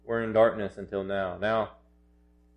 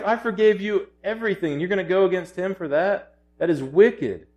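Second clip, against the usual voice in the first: high-pitched, acted outrage of a master; furious master; 7 semitones higher than usual. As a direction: act out the master's fury at the servant. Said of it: "He's absolutely furious".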